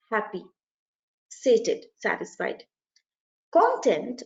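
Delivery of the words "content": "Content" stressed on CON, like KON-tent; stress on first syllable. Shown as a demonstration. Teacher giving the subject-matter meaning, stressing CON-tent. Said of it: "on the other hand, means subject matter".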